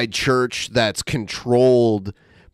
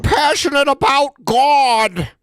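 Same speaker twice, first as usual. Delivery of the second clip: high, slow, hammed-up Canadian voice; mock Canadian accent; 10 semitones higher, 3 dB louder than usual. Mocking the speaker's passion about God, exaggerated Canadian accent. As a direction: stretch it out in an earnest Canadian whine. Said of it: "God with a Canadian accent".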